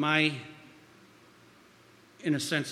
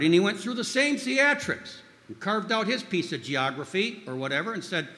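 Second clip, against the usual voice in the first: usual speaking voice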